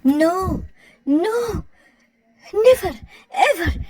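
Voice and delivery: haughty never ever; snooty refusal. Acted. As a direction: refuse like a posh snob. Posh mother refuses in English.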